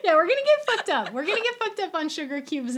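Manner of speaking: high, loud, fast glee; excited. Real, not acted. Host gleefully promising a wild drinking night. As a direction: blurt it out gleefully.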